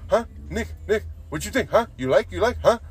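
high, loud, pestering coworker with mock Mexican accent; hammed-up eager coworker impression; imitated Mexican coworker. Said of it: "So it'd be like, uh".

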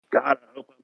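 cutting out mid sentence; muted words. Real, not acted. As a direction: voice dropping out on a bad line. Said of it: "you cut out real quick".

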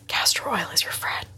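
breathy secret whisper. Pretend. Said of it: "She's whispering that to your yoni".

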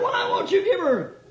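high, wailing lawyer's appeal; hammed-up emotional plea. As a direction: plead to the judge in mock anguish. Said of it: "go through the same emotion".

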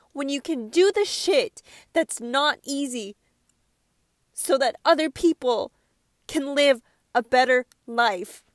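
pressing the point with feeling. Real, not acted. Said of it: "I get really emotional about stuff like this".

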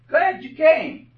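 high, slow and beaming greeting; glad welcome. Shown as a demonstration. Church greeter welcoming a visitor, high and slow. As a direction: welcome a newcomer with delight. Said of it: "somebody ought to be around to say".

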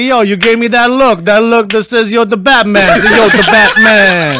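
hammed-up Bronx wise guy, loud; mock Bronx accent, projected. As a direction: play it like an Andrew Dice Clay Bronx routine. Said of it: "sounds like they're from the Bronx".

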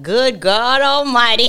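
lightly voiced old man, slow and loud; a hint of an amazed old man. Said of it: "This old guy one time, he was like".

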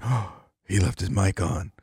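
soft imitation of a scandalized listener; whisper-soft pretend shock, as if overhearing; mock hushed alarm. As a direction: act out a hushed discovery. Imitating listeners not in on joke.

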